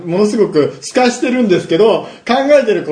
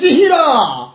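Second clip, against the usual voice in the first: very high, exaggerated shout-out; echoed title call; 9 semitones higher than usual. Co-host echoing the segment title, high hammed-up call. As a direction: echo the title with a heroic flourish.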